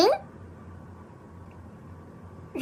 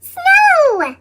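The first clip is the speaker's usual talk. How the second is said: much higher, loud joyful cry; delighted shout; 11 semitones higher, 5 dB louder than usual. Acted. Little Owl seeing the first snow, joyful cry.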